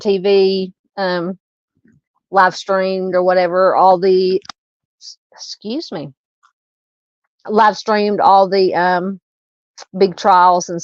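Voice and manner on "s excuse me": talking through hiccups. Real, not acted. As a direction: cut off by a hiccup. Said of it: "I have the hiccups".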